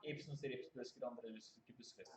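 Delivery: quiet, mumbled mock Swedish gibberish. Teasing friends with fake Swedish, mumbled and quiet. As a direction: fake a Swedish phrase under your breath. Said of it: "Something like Swedish".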